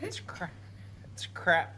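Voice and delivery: giggling fit, can't get it out; squeaky laughter through the words; hiccup giggles. Real, not acted. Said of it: "I can't stop laughing".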